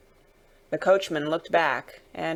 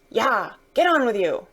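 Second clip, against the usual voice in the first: higher-pitched driver's cry; urging horses on; 4 semitones higher, 4 dB louder than usual. Acted. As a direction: drive the team on with a shout. Coachman urging on his horses.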